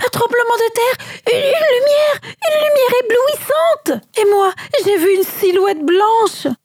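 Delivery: high, stammering frightened outburst; shaken soldiers. A narrator's reading. Soldiers telling the disciples what they saw, frightened stammer. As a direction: stumble over the words, still terrified. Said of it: "des soldats complètement bouleversés".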